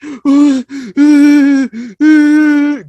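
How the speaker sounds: loud, high drawn-out mock wailing; mock crying. Retelling the victim's reaction, exaggerated fake crying. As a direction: imitate someone bawling in long whining sobs.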